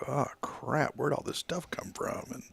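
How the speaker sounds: whispered groan at the pile of work; hushed, half-laughing oh crap; mock dismay. Retold desk dismay, whispered.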